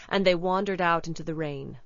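ordinary delivery, nothing marked

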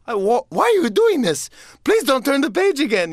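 high frantic Grover plea; pleading Grover. Retold or imitated. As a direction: beg the reader to stop, panicky. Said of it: "And he's like".

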